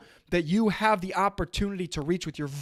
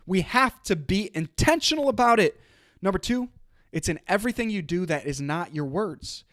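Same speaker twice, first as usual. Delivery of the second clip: ordinary delivery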